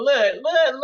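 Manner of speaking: loud, high call for attention; look, look, called out high. Real, not acted. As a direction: grab the crowd's attention.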